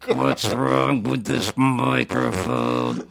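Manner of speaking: very slow synthesized speech; flat, slow computer voice; Stephen Hawking voice. Retold or imitated. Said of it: "his comedy, it would be like".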